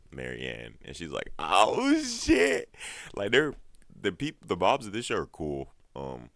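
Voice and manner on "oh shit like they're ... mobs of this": loud, rising falsetto exclamation; hammed-up high whoop of surprise; mock delighted shock. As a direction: imitate a mom making a big discovery. Acts mom spotting Marianne.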